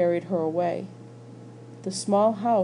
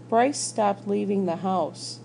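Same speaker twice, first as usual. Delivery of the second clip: plain reading voice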